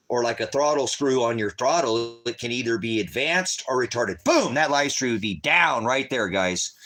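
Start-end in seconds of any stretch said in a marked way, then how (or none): 4.2-4.5 sharp, high, loud "Boom!"; sudden boom. Retold or imitated. Vlogger mimicking the algorithm killing his stream, loud. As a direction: blurt it out like a ban hitting.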